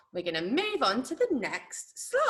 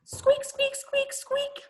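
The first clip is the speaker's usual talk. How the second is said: very high, tiny squeaks; short high-pitched squeaky voice; mouse squeak; 14 semitones higher, slower than usual. Shown as a demonstration. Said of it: "That is a squeaky sound".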